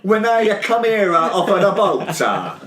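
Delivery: high, halting mock Italian accent; stumbling, high-pitched fake Italian accent; bad Italian accent. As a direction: ham up a terrible Italian-immigrant accent. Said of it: "his Italian accent's shit".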